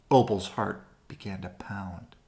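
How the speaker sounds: ordinary delivery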